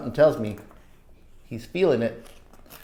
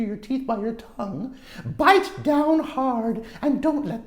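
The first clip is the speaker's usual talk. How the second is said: higher, patient mother voice; gentle mom advice; 12 semitones higher than usual. Acted. Mom advising her son at home, patient. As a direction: explain it kindly to your son.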